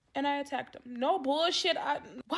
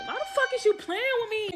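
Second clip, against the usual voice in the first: higher, louder indignant snap; outraged disbelief; 9 semitones higher, 5 dB louder than usual. Retold or imitated. To the short date, a louder outraged demand. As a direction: call him out, half laughing in outrage. Seeing date is four eleven.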